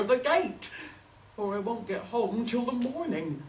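put-on storybook old woman, demanding help; insistent old woman. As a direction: plead your case to the dog, pitched high.

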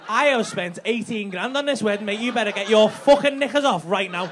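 loud, indignant mock rant; hammed-up fury over the wedding cost; husband ranting at his wife on the wedding night, hammed-up outrage; retold outraged demand. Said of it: "I was like".

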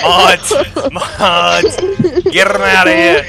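loud, high call for the mods; loud mock outrage. Real, not acted. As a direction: shout it out to the mods. Streamer calls mods, mock outrage.

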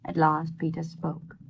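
ordinary delivery

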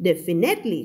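"definitely" as def-i-NET-ly, NET pushed up; wrong stress on third syllable. Shown as a demonstration. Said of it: "ก็ไม่เอา". (อ่าน definitely ผิด เน้นเสียงที่เน็ต)

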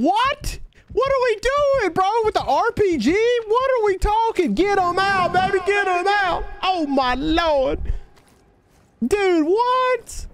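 high, hyped-up yelps; excited yelling. Real, not acted. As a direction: shout it out in pure gaming hype. Reacting wildly to teammate's loot.

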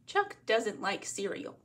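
plain reading voice

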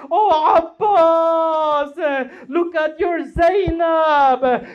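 drawn-out lamenting cry, imitating a mourner; wailing retold plea; retold crying shout. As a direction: call out in slow, stretched grief. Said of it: "and she shouted out".

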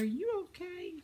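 high, soft imitation of a worried stranger; a light mock of a concerned shopper. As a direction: play the puzzled woman asking gently. Stranger asks if she's okay.

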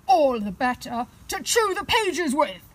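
loud wolf growl. Acted. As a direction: growl it out loud, the disguise dropped. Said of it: "growled the wolf".

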